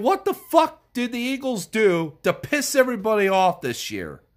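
usual speaking voice

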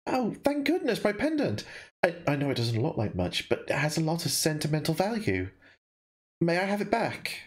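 put-on high, relieved young girl. Girl thanks the stranger for her pendant, high and relieved. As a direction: lift it up high, grateful and relieved.

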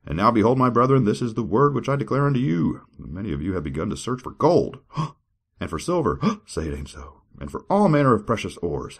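grand, high dramatic reading; scripture reading. Acted. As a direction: declaim it like a preacher.

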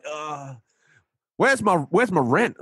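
high hammed-up New York landlord demanding rent; New York accent. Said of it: "New York accent".

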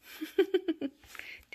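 high little giggle; chuckle. Real, not acted. Amused by playing kittens, high chuckle.

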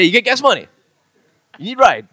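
loud Hawaiian pidgin pickup questions; short, punchy pidgin come-on; pidgin questioning up-talk. Acted. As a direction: push each pidgin line out as a question. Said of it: "everything's asking a question".

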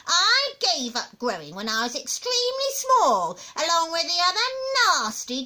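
crabby granny lecture; cantankerous old grandma. Acted. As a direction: reel off vices with disdain.

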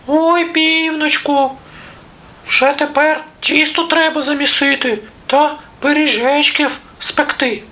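very high squeaky mouse voice; little mice. A narrator's reading. Mice tell the rooster dough needs kneading, squeaky voice. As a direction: squeak sweetly like a tiny mouse.

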